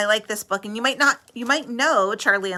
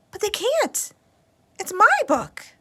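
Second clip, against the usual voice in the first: put-on indignant little girl; 13 semitones higher than usual. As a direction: insist the book is yours. Lola protesting.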